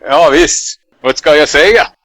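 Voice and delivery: loud Swedish in an American accent. Shown as a demonstration. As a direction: answer in fluent Swedish with a heavy American accent. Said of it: "fluent Swedish with a really bad American accent".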